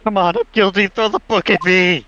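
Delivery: loud, hammed-up yell; screaming. Guilty man in the courthouse demanding punishment, screaming it. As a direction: shout it out theatrically. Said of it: "Who's screaming like that?".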